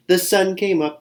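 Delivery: high, light chirpy squirrel voice; chirping squirrel. Acted. Squirrel gloating at the sunrise, high chirpy voice. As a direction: chirp it like a happy little squirrel. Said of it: "he chirped".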